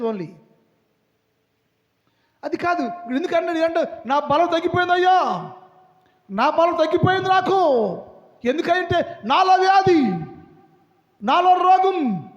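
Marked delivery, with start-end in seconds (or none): 2.4-12.3 high, loud, shouted sermon lament; fervent preaching. Acted. Pastor shouting of sin and sickness to the church, high and loud. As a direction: cry it out to the congregation.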